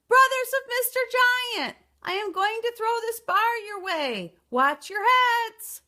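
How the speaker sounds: loud cry. A narrator's reading. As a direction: hurl the warning westward.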